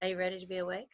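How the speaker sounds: mother's soft, loving wake-up call; gentle wake-up voice. Retold or imitated. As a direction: coax a sleepy child awake softly. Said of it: "And she'd say".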